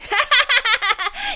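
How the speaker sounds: bright giggling laugh; child's laugh. A narrator's reading. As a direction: laugh at the silly noise. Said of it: "I laughed".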